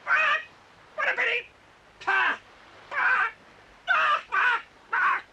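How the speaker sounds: loud, high yelps of pain; pained cries. Acted. Horace yelping as the stick hits his head. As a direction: cry out each time the stick lands.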